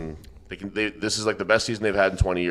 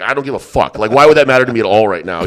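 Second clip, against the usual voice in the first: louder, heated "I don't give a fuck"; mock angry retort; 9 dB louder than usual. Narrator retelling his retort to the bar regular, heated and loud. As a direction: throw it back at him, fired up.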